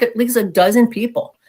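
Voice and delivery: normal talking voice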